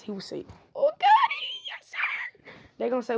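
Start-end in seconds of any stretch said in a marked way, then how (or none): 0.7-2.5 shrieked high, then breathy; a squealing falsetto "Oh, daddy" fading into a whispered "yes, sir"; hammed-up squeal then breathy hush; mock squeal. Acted over-the-top reply.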